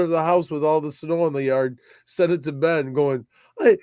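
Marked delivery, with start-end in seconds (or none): 3.6-3.8 excited. Retold or imitated. Retold message to Ben, excited.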